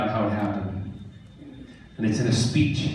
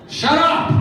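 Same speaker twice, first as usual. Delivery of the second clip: sharp, much higher and louder shout; shout to hush; 6 dB louder than usual. Real, not acted. Silencing a noisy convention crowd, loud shout. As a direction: cut the crowd's noise off with a yell.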